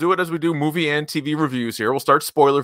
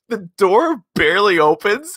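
normal talking voice

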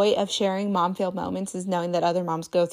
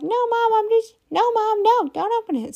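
put-on much higher, squeaky little kid protesting; toddler voice; 5 dB louder than usual. Toddler refusing to leave the porta potty, high squeaky protest. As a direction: play the three-year-old refusing to come out. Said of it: "She's like".

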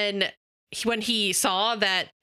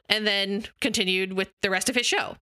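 usual speaking voice

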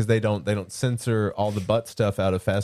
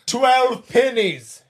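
slow, loud, higher archaic threat through a laugh; mock villain; 10 semitones higher, 4 dB louder, much slower than usual. Villain ordering his victim to pray, loud and hammed-up. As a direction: ham up the villain's doom warning.

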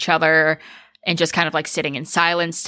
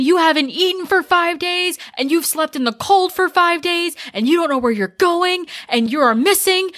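angry rant; 11 semitones higher than usual. Retold or imitated. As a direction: explode at her with rising fury. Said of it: "Josh exploding at Heather".

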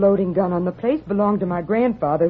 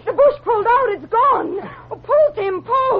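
high, loud panicked crying; 14 semitones higher, 3 dB louder than usual. Acted. Bush gives way, girl cries.

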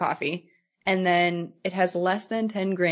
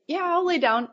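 quoting husband; 10 semitones higher, 3 dB louder than usual. Retold or imitated. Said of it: "And he's like".

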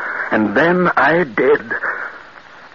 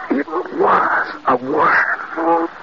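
horrified slow realization; much slower than usual. Acted. Horrified realization of giant worm.

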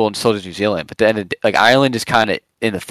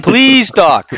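high, pleading patient begging his Irish doctor; desperate patient pleading for Adderall; 8 semitones higher, 3 dB louder than usual. Pretend. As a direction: beg the doctor for pills, voice up high. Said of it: "you're my Irish doctor, and I'm begging you".

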